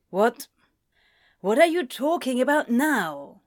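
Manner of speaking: puzzled, slightly annoyed question; a touch of exasperation; mild irritation. A narrator's reading. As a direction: let irritation creep back in. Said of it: "the irritation returning a little".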